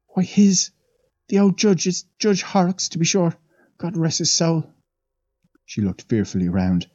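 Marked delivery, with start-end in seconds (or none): 0.1-4.7 a lightly voiced old maid, awed; old Irish housemaid. The maid leans in to the young men, lightly voiced and awed. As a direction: tell it like a wide-eyed servant gossiping.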